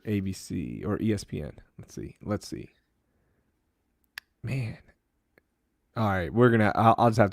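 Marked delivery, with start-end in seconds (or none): 0.0-4.8 much softer, muttered search; searching online, muttering to himself. Real, not acted. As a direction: mumble it under your breath.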